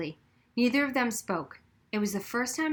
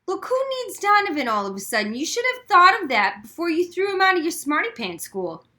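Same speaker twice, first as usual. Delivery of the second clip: high, louder sneer; 8 semitones higher, 6 dB louder than usual. A narrator's reading. Taller Daniel scolding the girl, sneering. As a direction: sneer down at her.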